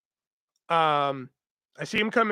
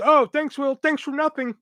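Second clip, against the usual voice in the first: mock sarcasm; 9 semitones higher than usual. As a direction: mimic a snide coworker, pitched way up. Mimics coworker's sarcastic parting jab.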